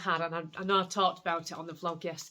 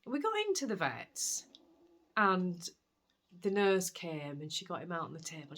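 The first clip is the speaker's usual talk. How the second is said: ordinary talk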